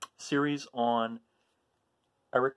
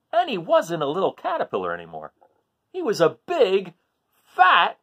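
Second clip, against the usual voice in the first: louder, higher storybook reading, building with each day; animated picture-book narration for a class; reading to children; 8 semitones higher, 8 dB louder than usual. Acted. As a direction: read the list with growing excitement.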